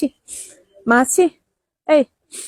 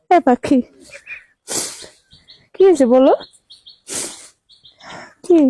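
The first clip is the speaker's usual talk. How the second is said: raised, louder voice over the crying; 4 dB louder than usual. Real, not acted. Calling to orphaned crying children.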